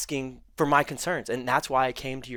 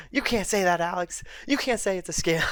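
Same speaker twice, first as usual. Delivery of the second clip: higher, whiny mock scolding; a mock-prissy scold, higher; mocking Alex; 7 semitones higher than usual. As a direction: parrot his scolding in a higher voice. Mocking Alex telling him off.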